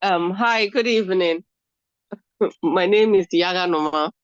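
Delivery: blocked nose from a head cold; stuffy nose. Real, not acted. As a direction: speak through a stuffy cold. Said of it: "Sorry, I have a cold".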